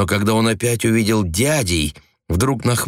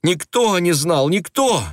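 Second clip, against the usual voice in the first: high, slow, pleading; insist, voice climbing in despair; desperate insistence; 9 semitones higher than usual. A narrator's reading. Wife insisting to husband, desperate high plea.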